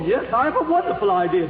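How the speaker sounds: ordinary talk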